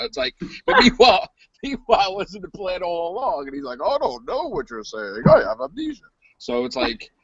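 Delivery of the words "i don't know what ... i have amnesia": mock feigned ignorance. Host imitates director hiding the plan, mock clueless denial. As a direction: play dumb in a put-on high voice.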